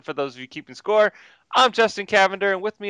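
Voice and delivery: normal talking voice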